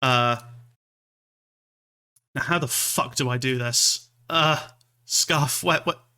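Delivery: normal talking voice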